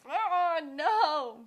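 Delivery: high loud disappointed groan; dismayed cry. Retold or imitated. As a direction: wail it in frustration. Woke before answer.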